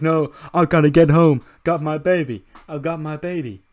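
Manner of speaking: high, loud acted protest; hammed-up frantic character voice; mock frantic shout. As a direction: shout it like someone desperate to get away. Voicing man running off with title.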